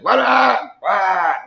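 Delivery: high, mock shouted question; imitating a hard-of-hearing marital spat; arguing, can't hear. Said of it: "argue and don't even hear each other".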